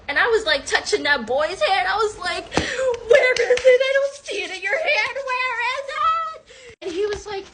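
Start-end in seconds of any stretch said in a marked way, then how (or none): 2.5-6.8 high, wailed mock disbelief; frantic demand. Woman searching his hand in vain, frantic high wail. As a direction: squeal it, dragging out the question.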